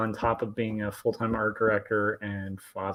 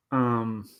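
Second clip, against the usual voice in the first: normal talking voice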